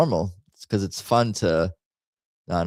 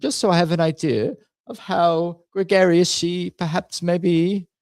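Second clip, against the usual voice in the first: put-on posh British accent; 6 semitones higher than usual. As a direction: play a fussy Brit asking politely how drunk Mother is. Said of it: "do the accent".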